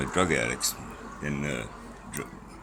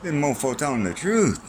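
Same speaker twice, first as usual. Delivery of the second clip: much higher, louder mock reader voice; readers convinced; 9 semitones higher, 6 dB louder than usual. Imitated readers admitting he told the truth, high and loud. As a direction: play an impressed reader, pitched way up. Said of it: "they're gonna say".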